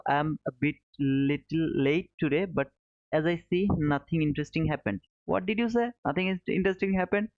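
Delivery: ordinary talk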